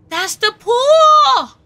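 loud, high, drawn-out exclamation; shocked realisation. Real, not acted. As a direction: shout it slowly as it dawns on you. Sudden realisation about the pool.

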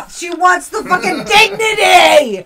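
high yell through laughter; laughing shout. Real, not acted. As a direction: cry it out laughing. Laughing outrage over sweater.

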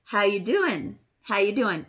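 normal talking voice

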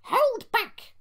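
high-pitched, loud stage whisper; squeaky plotting coach; conspiratorial. A narrator's reading. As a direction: pass on a secret order in a squeaky voice. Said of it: "whispered Jemima to Lucy".

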